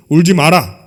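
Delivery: loud, curt order; firm command. Acted. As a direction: say it once, decisively. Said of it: "딱 얘기하시는 거예요". (단호하게 딱 잘라)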